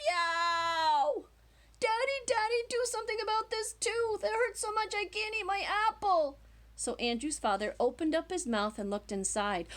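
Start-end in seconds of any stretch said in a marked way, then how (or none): 0.1-6.4 put-on high, whiny child crying out in pain; childish yell. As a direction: wail like a little boy with a sore tooth. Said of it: "and yelled".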